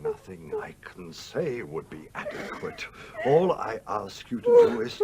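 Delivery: whispered, near tears; tearful whisper. Acted. Older woman apologising to Lucy, whispering through tears. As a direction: voice failing with shame.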